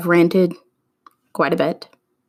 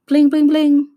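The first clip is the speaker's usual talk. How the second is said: high loud mock ringtone; hammed-up ringing phone; phone ring imitation; 8 semitones higher, 6 dB louder than usual. Shown as a demonstration. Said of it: "I'm gonna reenact the phone call".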